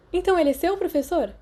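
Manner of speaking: flirty question. A narrator's reading. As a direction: bat your lashes and flirt with the teacher. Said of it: "batendo o cílio sedutoramente". (voz sedutora e provocante, bem mais aguda)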